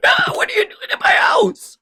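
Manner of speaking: high, breathy shriek of a monster; strained, screechy Cthulhu cry. Retold or imitated. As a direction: shriek it like an offended eldritch god. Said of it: "Cthulhu is like".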